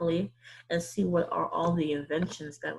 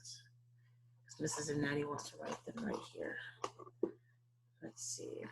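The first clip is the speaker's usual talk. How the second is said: whispered aside to the class; 14 dB quieter than usual. Acted. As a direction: whisper as if sharing a secret. Teacher drops voice writing.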